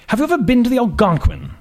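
put-on upper-class prep school and British accent; lofty aristocrat sizing up a young woman; aristocratic accent. Magazine boss greeting a job candidate, aristocratic accent. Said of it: "His accent was patently aristocratic".

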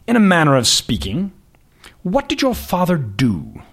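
put-on slow, lofty prep-school British accent; slow aristocratic accent. Boss questioning the candidate slowly in an aristocratic accent. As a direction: savour each word like a bored aristocrat. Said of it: "His accent was patently aristocratic".

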